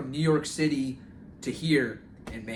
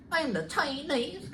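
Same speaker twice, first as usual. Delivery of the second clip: slow, high mock Chinese accent; drawn-out hammy Chinese accent; 10 semitones higher, 4 dB quieter, slower than usual. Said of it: "I can do a Chinese accent".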